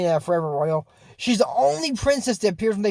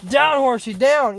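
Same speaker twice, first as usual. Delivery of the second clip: raised, urgent mock command; calling to horse; 6 semitones higher, 4 dB louder than usual. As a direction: call the horse down, higher and louder.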